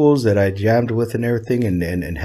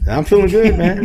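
tipsy and happy; 11 semitones higher, 3 dB louder than usual. Real, not acted. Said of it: "I am absolutely buzzed right now".

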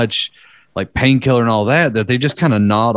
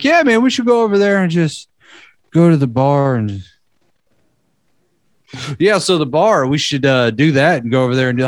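stoned buddy voice; 3 semitones higher than usual. Retold or imitated. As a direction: play the nodding friend, dazed and oblivious. Friend resumes stale conversation, stoned.

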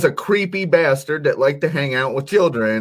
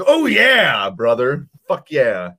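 Hulk Hogan. Retold or imitated. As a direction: yell it like a wrestling promo. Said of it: "Hulk Hogan shit".

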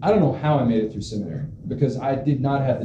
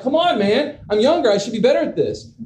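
high, pleading complaint to himself; mock indignant protest, pitched high; frustrated whine; 9 semitones higher than usual. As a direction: whine like a sore loser. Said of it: "I'm like".

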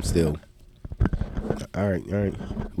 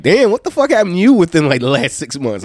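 imagined shocked friends; 8 semitones higher, 3 dB louder than usual. Retold or imitated.

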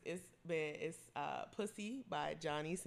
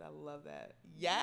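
ordinary talk

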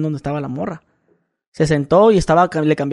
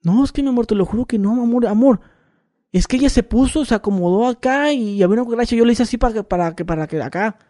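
higher, hurried pleading excuses; pleading boyfriend; 5 semitones higher than usual. Retold or imitated. Boyfriend pleading with his girlfriend to believe him, higher.

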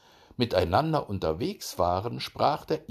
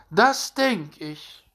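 high, loud, drawn-out hare voice; conceited hare, slow and high; smug hare; 10 semitones higher, 7 dB louder, much slower than usual. A narrator's reading. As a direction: stretch it out high and self-satisfied. Smug hare answers the hedgehog.

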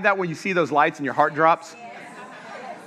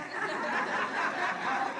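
breathy silent laugh; 13 dB quieter than usual. Real, not acted. Laugh after punchline.

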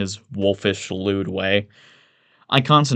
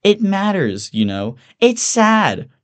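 very high, whiny mock pity; mock concern; 11 semitones higher, 5 dB louder, slower than usual. Mock plea, echoed by Bateman.

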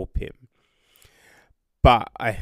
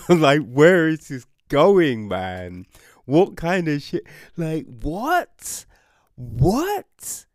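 higher, rising disbelief; incredulous; 5 semitones higher, 5 dB louder than usual. Real, not acted. Reviewer baffled by where the book goes, rising disbelief. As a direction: climb higher with each what.

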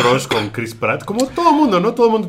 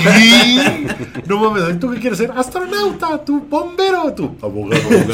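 pitched way up, mocking; high mocking kid voice; 10 semitones higher than usual. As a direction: tease like a schoolkid. Mocking childhood career list.

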